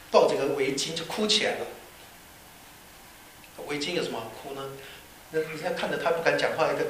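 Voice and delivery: usual speaking voice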